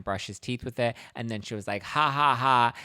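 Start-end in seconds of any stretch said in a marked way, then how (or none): 1.8-2.7 loud, high taunting ha ha ha; spelled-out gloating laugh; mocking laugh. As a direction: cackle at him in triumph. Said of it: "she was laughing at him".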